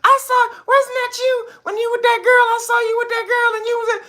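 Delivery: very high, loud accusing falsetto; hammed-up high accusing girlfriend; shrieking girlfriend. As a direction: squeal the accusation like the angry girlfriend. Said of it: "And she said".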